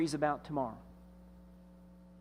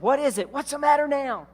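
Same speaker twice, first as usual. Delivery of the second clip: woken father; 7 semitones higher, 5 dB louder than usual. Acted. Father woken again by son.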